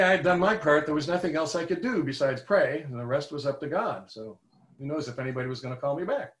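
ordinary delivery, nothing marked

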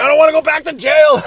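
loud, high-pitched hammed-up yell; retold yelling. Yelling at a father and son, hammed-up shout. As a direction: yell it out in mock panic. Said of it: "I think I started yelling".

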